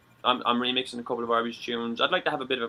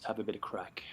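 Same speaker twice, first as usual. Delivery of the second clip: a sly whispered afterthought; whispered aside; 6 semitones lower, 15 dB quieter than usual. Real, not acted. Teller slips in the pun on crack, whispered. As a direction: whisper the pun under the breath.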